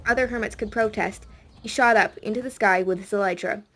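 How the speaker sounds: plain reading voice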